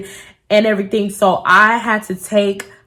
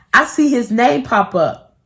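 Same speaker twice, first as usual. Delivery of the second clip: usual speaking voice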